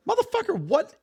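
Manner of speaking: incredulous outburst. Real, not acted. Friend hears the chips story, stunned disbelief. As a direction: pitch jumps way up in disbelief. Outraged at fish without chips.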